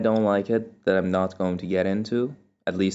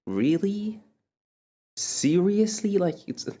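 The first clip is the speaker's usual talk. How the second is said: high, incredulous really and seriously; drawn-out seriously, as if you can't believe it; exasperated disbelief; 11 semitones higher, slower than usual. Retold or imitated. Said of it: "It's kind of like".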